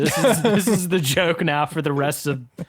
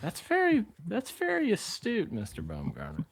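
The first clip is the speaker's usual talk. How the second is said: put-on pompous old gentleman; 3 semitones higher, 9 dB quieter than usual. Mock formal compliment.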